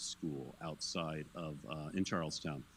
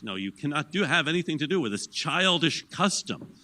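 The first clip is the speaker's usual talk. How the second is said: raised, higher voice of an outraged general; 8 semitones higher, 9 dB louder than usual. Retold or imitated. Lecturer voicing Washington forbidding the soldiers' custom, outraged and loud. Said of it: "And he's outraged".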